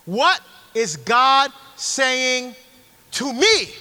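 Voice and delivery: very slow, each word weighted; slow emphasis. Real, not acted. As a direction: make them hear every word. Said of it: "Make it personal".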